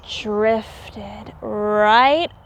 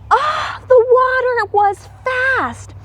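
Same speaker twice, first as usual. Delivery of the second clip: alarmed cry; 11 semitones higher than usual. A narrator's reading. Narrator reading to children, crying out in alarm. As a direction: cry out as the current grabs them. Squirrels swept in water.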